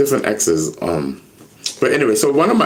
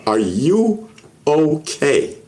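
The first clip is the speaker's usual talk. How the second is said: slow, drawn-out "are you okay"; pressing concern; much slower than usual. Retold or imitated. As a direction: stretch "you" and "okay" to insist on an answer. Retold friend pressing with concern.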